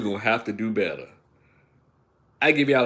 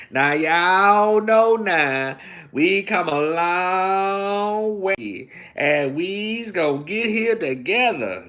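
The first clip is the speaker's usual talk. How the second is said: loud, very slow put-on Southern preacher drawl; drawn-out mock sermon voice; 7 semitones higher, 5 dB louder, much slower than usual.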